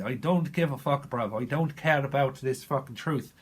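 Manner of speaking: put-on defiant Irish-accented tirade; hammed-up Irish outburst. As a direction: rant it out in a broad Irish accent. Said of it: "Someone's from Ireland".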